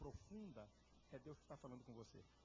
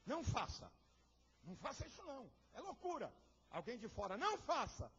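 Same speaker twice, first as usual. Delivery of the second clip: high, louder urgent warning; warning voices; 9 semitones higher, 11 dB louder than usual. Acted. Emotions and outsiders warning him, high urgent cry.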